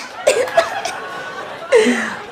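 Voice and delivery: high, soft giggle; high breathless laughter; laughing. Real, not acted. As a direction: giggle helplessly at your own joke. Laughs at own Dora bit.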